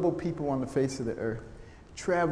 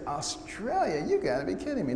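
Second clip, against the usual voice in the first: higher-pitched disbelief; incredulous; 5 semitones higher, 3 dB quieter than usual. Acted. Young doctor reacting to an invitation to Australia, incredulous. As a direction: balk at a crazy suggestion.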